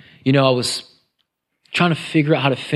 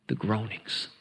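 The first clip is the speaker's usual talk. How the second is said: very soft and low, drawing the listeners in; hushed groaning; 6 semitones lower, 11 dB quieter than usual. Shown as a demonstration. Preacher revealing what God hears, hushed. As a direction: drop to a near whisper. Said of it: "watch this".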